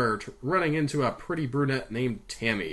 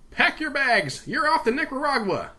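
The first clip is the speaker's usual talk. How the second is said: higher-pitched, brisk order; crisp command, pitched up; 13 semitones higher, 4 dB louder than usual. A narrator's reading. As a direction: send him off like a boss. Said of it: "Gavin commanded".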